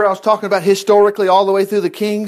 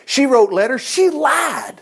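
indignant; 6 semitones higher, slower than usual. Real, not acted. Preacher condemning Jezebel.

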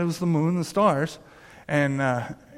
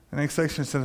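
normal talking voice

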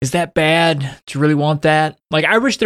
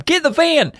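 high urgent yell, much higher; shouting. Retold or imitated. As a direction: bark the order in a panic. Gamer friends panicking in heist.

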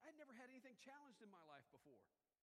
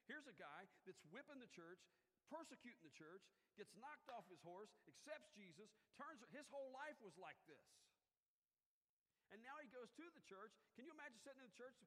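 rising, louder preacher's build; preaching; 4 semitones higher, 5 dB louder than usual. Acted. Pastor preaching Paul's conversion to the church, fervent. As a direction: drive the story home to the congregation.

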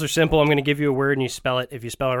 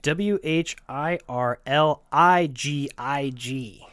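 slow letter-by-letter spelling; 3 semitones lower, much slower than usual. Shown as a demonstration. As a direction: spell each letter out slowly. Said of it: "The word is whirligig".

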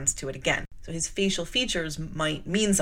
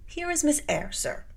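usual speaking voice

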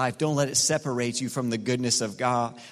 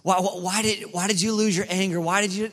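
mock accusing questions; 7 semitones higher than usual. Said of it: "here's what he doesn't ask".

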